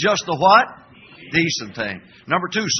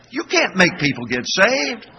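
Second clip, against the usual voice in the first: ordinary talk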